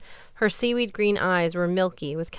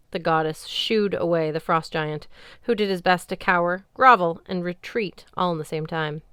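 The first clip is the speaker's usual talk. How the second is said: ordinary delivery, nothing marked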